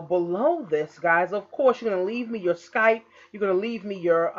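ordinary talk, nothing marked

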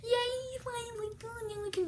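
high laughing cheer; excited. Real, not acted. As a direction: squeal with victory. Victory in toy battle.

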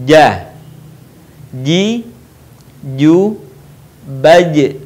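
'ja, ji, ju, baj' with tongue middle on the palate; middle-tongue letter jim. Shown as a demonstration. Teacher showing the letter jim, each syllable sounded separately. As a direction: sound out 'ja, ji, ju, baj' singly, 'baj' bouncing. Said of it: "Huruf ini dilafalkan dengan menyentuhkan tengah lidah".

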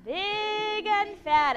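"big and fat" very slow, high and loud; emphatic phrasing. Shown as a demonstration. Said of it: "Can we do that?".

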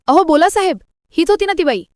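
high, loud shrill demand from aunt; shouting. A narrator's reading. Aunt shouts at Arnav to name the attacker, loud. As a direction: yell it at him, pushing. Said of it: "मामी ओरडते".

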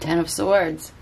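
ordinary delivery

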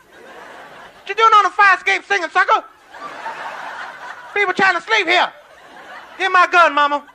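high, loud shouted threats; hammed-up furious shouting, pitched high; angry neighbor yelling. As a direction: yell at singers keeping you awake.

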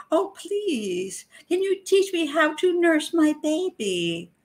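high, slow, sugary little plea; sweet helpless mother act, mocked; mock sweet innocent voice. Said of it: "presenting to the public a very sweet image".

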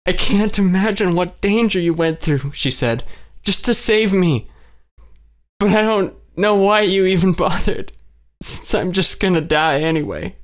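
weeping hopelessness; tearful despair. A narrator's reading. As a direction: grieve her own fate.

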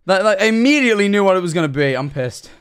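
loud, exasperated self-reproach; pissed at oneself. Real, not acted. Streamer annoyed at recognising a clip immediately, pissed at oneself. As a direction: start high and loud, dropping to an annoyed finish. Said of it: "I'm really pissed at myself".

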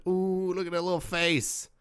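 high cooing pet talk; sweet high coo to a cat on her lap. Real, not acted.

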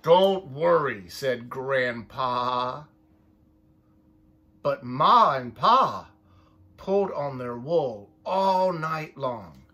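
lively picture-book read-aloud, voices for each sheep; reading to children. A narrator's reading. Parent reading a sheep story to the child, lively storytime voice. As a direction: read it like storytime for little ones.

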